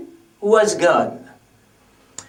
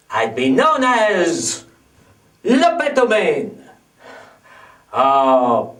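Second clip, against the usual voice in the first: ordinary delivery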